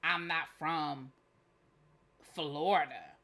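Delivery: lightly mocking mimic, stretched out; mocking imitation. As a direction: drag it out to mock the other guy. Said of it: "took it some kind of way".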